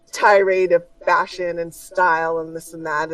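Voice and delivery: ordinary talk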